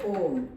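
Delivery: ओम with a lengthened O; prolonged vowel. Shown as a demonstration. Teacher giving an example of the extra-long vowel, drawn out. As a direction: stretch the O of ओम before closing on m. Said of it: "दीर्घ स्वर के उच्चारण से भी अधिक समय".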